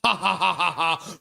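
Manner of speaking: spelt-out "ha ha ha" laugh; read-out laughter. Acted. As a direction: perform the typed laugh, syllable by syllable. Reads typed laughter from chat.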